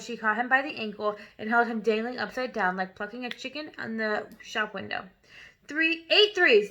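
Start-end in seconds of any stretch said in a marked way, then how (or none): 5.7-6.7 loud, slow, higher shout. A narrator's reading. Headmistress shouting while swinging the boy, slow and loud. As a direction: bellow it at the boy. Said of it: "the Trunchbull shouted".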